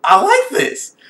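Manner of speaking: gleeful squeal. Retold or imitated. Reacting to the scene, a high delighted squeal. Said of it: "I'm just like".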